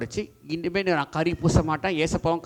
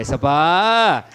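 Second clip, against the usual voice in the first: very slow, drawn-out ஏசப்பா; prompted response; 6 dB louder, much slower than usual. Shown as a demonstration. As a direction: stretch ஏசப்பா for the children to copy. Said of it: "சொல்லு". (ஏசப்பா நீட்டி மெதுவாக)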